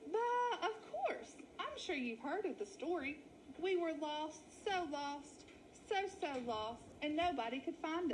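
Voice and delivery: high, fluttery dramatic lady; milk the sad lost story; wolf faking a sheep lady. Acted. Wally plays lost lady sheep.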